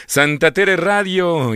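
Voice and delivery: showman's welcome announcement; ringmaster. Acted. Radio host opening the show.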